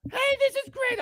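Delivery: very high, jolly put-on voice; mock-cheery high squeal. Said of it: "all jolly".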